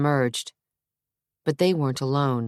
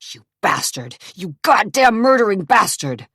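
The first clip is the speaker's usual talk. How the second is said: loud, higher enraged yell; furious yell; 6 semitones higher, 5 dB louder than usual. A narrator's reading. Zach to his brother while pulled off, yelling. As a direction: hurl it at him. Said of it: "Zach yelled".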